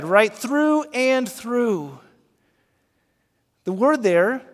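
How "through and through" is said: through and through very slow, pressed like kneading bread; kneading rhythm. Shown as a demonstration. Pastor miming kneading bread, slow and pressed. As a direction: push each through like kneading dough. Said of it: "like we're kneading bread".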